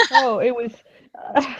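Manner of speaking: ordinary talk, nothing marked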